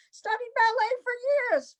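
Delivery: a hint of the breezy actress boasting; imitating Amanda. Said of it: "but she said".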